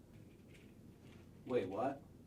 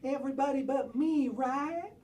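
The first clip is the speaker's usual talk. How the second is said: normal talking voice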